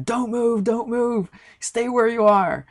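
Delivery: high-pitched imitation of frantic yelling; mock screaming. Army Ranger warning an upside-down climber, mock screaming. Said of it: "he's yelling and screaming".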